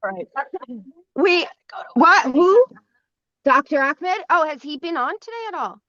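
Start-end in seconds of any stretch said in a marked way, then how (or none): none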